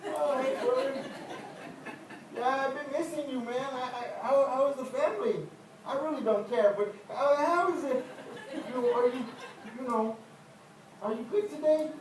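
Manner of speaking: high, loud, puffed-up greeting; proud church greeter. Retold or imitated. Proud churchgoer greets the congregation, high loud and showy. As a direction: strut and glad-hand everyone. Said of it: "I just walk around church like this".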